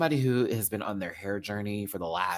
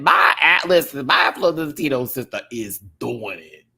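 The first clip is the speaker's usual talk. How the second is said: proud cheer; 4 semitones higher, 10 dB louder than usual. Retold or imitated. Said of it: "I said".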